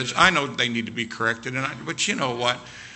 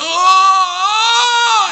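long, high, loud howl of agony; full-throated acted scream; pain scream; 12 dB louder than usual. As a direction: scream as if your foot is crushed. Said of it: "I'm screaming".

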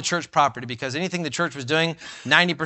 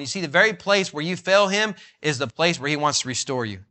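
ordinary talk, nothing marked

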